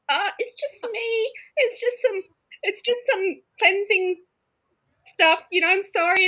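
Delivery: squeaky high, slow sheepish voice; new housemate apologising for the smoke, sheepish high voice; sheepish apology. Retold or imitated. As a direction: make yourself small and apologise to the roommates. Apologising to startled roommates.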